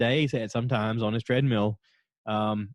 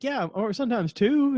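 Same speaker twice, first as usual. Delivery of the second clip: much higher-pitched, offhand confession; mock innocent admission; 12 semitones higher than usual. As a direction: imitate someone owning up without shame. Bert admitting even more, innocently.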